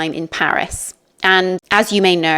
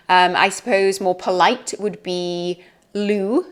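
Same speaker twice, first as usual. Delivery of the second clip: ordinary delivery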